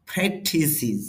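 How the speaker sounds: ফ্রেকটিসিস said as PRAK-ti-sis, stress on the first syllable; correct pronunciation. Shown as a demonstration. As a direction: read ফ্রেকটিসিস with a short i in each syllable and no -es. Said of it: "তাহলে উচ্চারণটা হবে".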